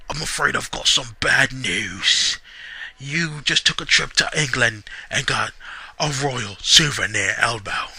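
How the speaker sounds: mock Wade Barrett, slow and smug. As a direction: deliver bad news with smug menace. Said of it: "doing voices really hurts".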